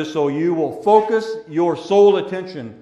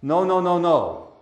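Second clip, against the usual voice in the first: ordinary talk, nothing marked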